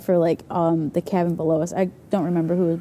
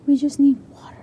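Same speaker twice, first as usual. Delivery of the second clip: whisper; 6 semitones higher than usual. Retold or imitated. Girls whining at night.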